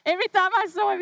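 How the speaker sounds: laughing through the words, high and loud; fond laugh spilling into the line; laughing memory. Real, not acted. Laughing as she recalls grandfather.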